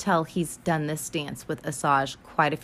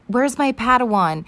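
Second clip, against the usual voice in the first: loud, high hot-headed demand; raised-voice acted outburst; Anakin; 5 semitones higher, 6 dB louder than usual. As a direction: demand it with mock fury. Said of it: "his hot-headed ass".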